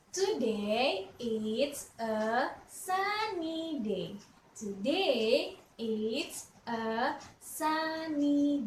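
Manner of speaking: slow drawn-out model sentence; slow teacher model. Shown as a demonstration. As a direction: say it slowly for kids to repeat. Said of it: "say what I say".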